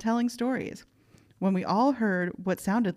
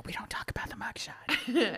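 hushed, secretive whisper; mock whisper; 9 dB quieter than usual. Joking whisper about mugshot.

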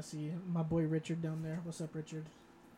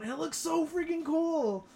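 excited; 10 semitones higher, 3 dB louder than usual. Real, not acted. Gushing about underground lake.

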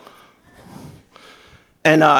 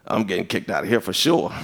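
much softer and lower, almost to himself; hushed worry; 10 semitones lower, 8 dB quieter than usual. Retold or imitated. Preacher fearing he'll be kicked out, much softer and lower. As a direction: mutter it like you know you're in trouble. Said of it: "I was like".

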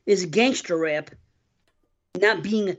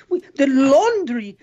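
slow, drawn-out imitation of the Chinese laundry lady; imitating her accent; 4 semitones higher, 6 dB louder, slower than usual. Podcast host quoting the prank call lady, slow mock accent. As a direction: mimic her broken English, dragging the words. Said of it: "the way she says".

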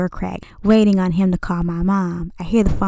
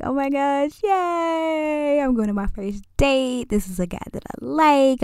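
hyped; 6 semitones higher, 3 dB quieter than usual. Retold or imitated. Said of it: "I'm like".

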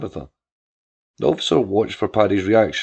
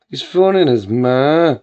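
slow, loud, higher mocking Belfield accent; jeering copy of a Belfield accent; 4 semitones higher, 8 dB louder, slower than usual. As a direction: sneer it in a put-on Belfield accent. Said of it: "imitating the harsh Belfield accent Paddy had".

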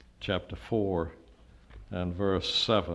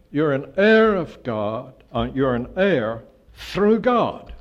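"heir" and "God" slow, loud and higher; emphatic; 4 semitones higher, 7 dB louder, slower than usual. Shown as a demonstration. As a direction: lean hard on "heir", then "God". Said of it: "emphatic position".

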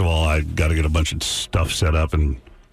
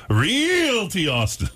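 slow, high announcer read; radio announcer; 12 semitones higher, much slower than usual. Shown as a demonstration. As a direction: sell it like a radio spot. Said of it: "I'll W N B C her".

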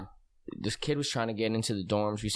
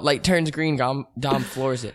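ordinary delivery

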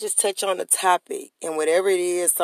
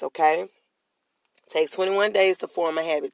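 ordinary talk

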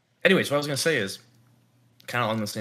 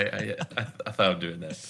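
ordinary talk, nothing marked

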